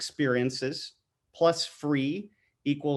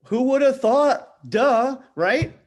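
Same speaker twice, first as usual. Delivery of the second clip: sarcastic; 9 semitones higher, 6 dB louder, slower than usual. Real, not acted. Mock surprise about obvious point.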